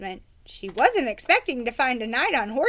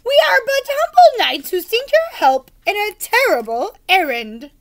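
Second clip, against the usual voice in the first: grand knight; 9 semitones higher, 9 dB louder than usual. Acted.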